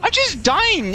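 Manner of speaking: high, slow cry of exasperation; drawn-out frustrated outburst; exasperated disbelief. Real, not acted. Dies instantly again.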